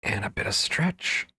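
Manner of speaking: soft whisper while stretching. Real, not acted. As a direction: breathe it out in a whisper. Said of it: "ready for a little breathing and stretching".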